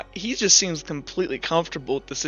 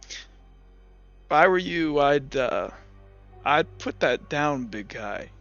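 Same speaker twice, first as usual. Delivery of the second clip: slow, low, quiet warning; unbothered stranger; 4 semitones lower, 9 dB quieter, much slower than usual. Acted. Cane-carrying stranger warning Randy off his axe, unbothered. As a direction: say it slowly and softly, trailing lower. Game master voices menacing stranger.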